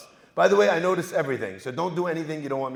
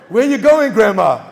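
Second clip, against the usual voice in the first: high, loud call after someone; 5 semitones higher, 11 dB louder than usual. Retold or imitated. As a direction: shout it after her, mock-urgent. Calls after grandma in retold story.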